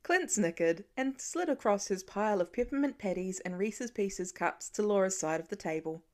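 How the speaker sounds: ordinary delivery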